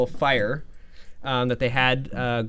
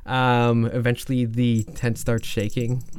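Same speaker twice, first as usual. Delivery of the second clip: usual speaking voice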